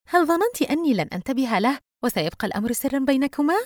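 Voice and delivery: high-pitched teasing interrogation; sly, smiling. A narrator's reading. Friend probes Yusra about her secret visitor, slyly teasing. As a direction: tease out the secret with a sly smile. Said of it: "دينا باسمة بخبث".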